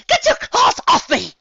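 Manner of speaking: high, loud shriek, slowly. A narrator's reading. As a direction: shriek it while struggling. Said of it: "she shrieked".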